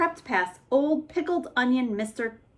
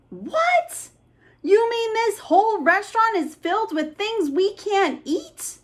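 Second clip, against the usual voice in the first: very high disbelieving cry with a laugh; be flabbergasted; shocked monster; 11 semitones higher than usual. Acted. Monster learning books aren't food, shocked.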